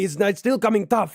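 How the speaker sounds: high, loud chef scolding a cook; angry chef. Acted. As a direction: bark at the cook like a furious head chef. Chef repeats complaint.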